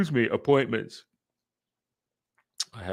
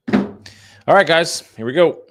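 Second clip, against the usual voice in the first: loud, much higher hype-up shout; hype call; 9 semitones higher, 9 dB louder, slower than usual. Real, not acted. Vlogger rallying his viewers, loud and much higher. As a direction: pump up the viewers for the dial session. Hyping up the start of dialing.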